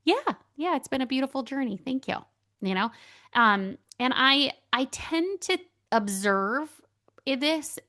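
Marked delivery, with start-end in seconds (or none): 0.0-2.2 high, quick, happy acceptance; bright high thank-you, said fast; gracious acceptance. Shown as a demonstration. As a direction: take the compliment lightly with a lifted voice. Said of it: "I could just say".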